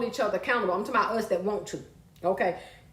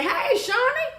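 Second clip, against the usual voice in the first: high calling hello; higher call to a viewer; excited hello; 13 semitones higher, slower than usual. Real, not acted. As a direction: sing out a greeting. Greeting a viewer.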